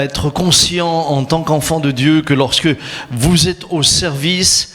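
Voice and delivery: normal talking voice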